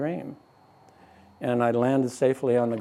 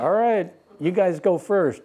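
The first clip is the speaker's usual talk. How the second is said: laughing; 6 semitones higher than usual. Retold or imitated. Nervously lets others go first.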